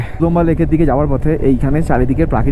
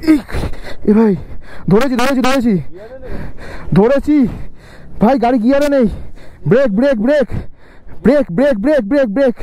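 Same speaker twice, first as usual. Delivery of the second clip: urgent shouting; 8 semitones higher than usual. Real, not acted. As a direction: yell it over the engine, urgently. Pushing stuck bike, calling to rider.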